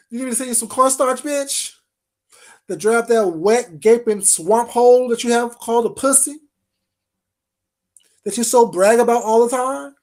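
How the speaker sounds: loud sneering taunt; mocking taunt. Real, not acted. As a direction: taunt her loudly. Insulting her loudly.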